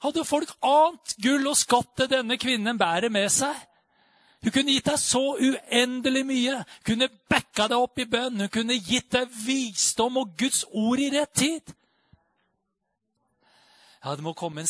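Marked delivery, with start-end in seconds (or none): 0.0-11.7 high, loud, impassioned preacher voice; rising revival preaching; fervent preaching. Acted. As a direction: preach it out with full fire. Praising the praying woman.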